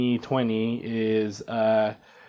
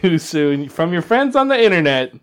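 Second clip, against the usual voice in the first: announcer sign-off; 5 semitones higher, 5 dB louder than usual. Pretend. Hammy podcast sign-off plug.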